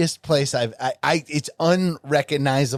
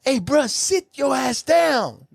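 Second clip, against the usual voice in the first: annoyed shout; 10 semitones higher, slower than usual. Retold or imitated. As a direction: bark at him to sit down. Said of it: "this one guy's like".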